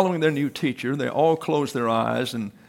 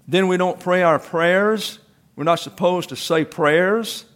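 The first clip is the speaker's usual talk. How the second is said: raised, baffled questions; puzzled; 5 semitones higher, 4 dB louder than usual. Acted. Puzzled children questioning their teacher, raised voices. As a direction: ask in dismay.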